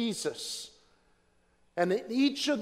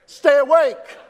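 urgent cry; 8 semitones higher, 13 dB louder than usual. Real, not acted. Preacher warning the church to be ready, urgent high shout.

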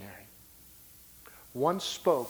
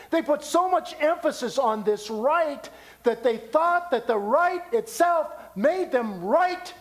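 high, driving sermon delivery; forceful preaching; 11 semitones higher, 6 dB louder than usual. Real, not acted. Preacher explains circumcision to the congregation, preaching at full force. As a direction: preach it emphatically with raised pitch.

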